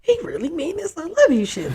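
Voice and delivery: high, disbelieving inner voice; reacting to his constant I love yous, high and incredulous. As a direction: scoff at it in disbelief.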